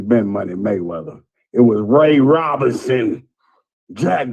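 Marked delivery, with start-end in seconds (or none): none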